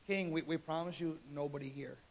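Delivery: low, very soft protest; hushed, humble mock reassurance; meek soldiers. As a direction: placate an angry king, voice dropped low. Retold soldiers placate angry king.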